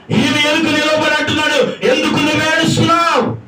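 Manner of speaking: high, ringing proclamation; cried-out preaching. Acted. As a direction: call the question out over the congregation. Preacher cries out God's question.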